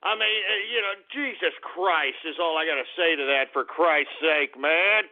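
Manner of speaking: usual speaking voice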